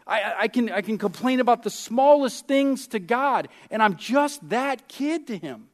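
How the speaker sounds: ordinary talk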